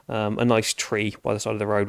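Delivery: ordinary delivery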